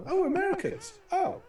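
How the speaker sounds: high-pitched mock-wife exclamation; wife's reaction. Wife finding Americans asleep in the lounge, high mock exclamation. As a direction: squeal it as the exasperated wife, hammed up. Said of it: "she's going".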